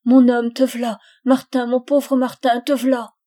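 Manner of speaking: loud, high tearful cry of relief; cling to him and sob his name out; sobbing embrace. A narrator's reading. Wife sobbing as her lost husband returns, loud and tearful. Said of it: "elle se jeta sur sa poitrine en sanglotant".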